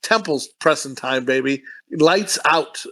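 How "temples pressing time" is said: usual speaking voice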